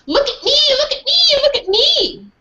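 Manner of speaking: high, gleeful shouting of look at me; a thrilled puppy showing off; excited dog. Acted. Dog excited rolling in cart.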